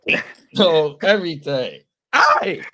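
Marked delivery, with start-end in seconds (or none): 2.1-2.6 very high, loud ad-lib yell; hype shout. Acted. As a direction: throw a rap ad-lib hey. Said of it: "Hit her with that hey".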